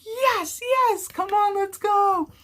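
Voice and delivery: squealing with delight, high-pitched; excited cheering. Retold or imitated. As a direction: cheer her on, thrilled she's doing it. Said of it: "I was so excited".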